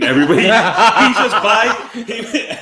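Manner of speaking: real laughter bubbling through the words; laughing. Real, not acted. Laughing while telling the story.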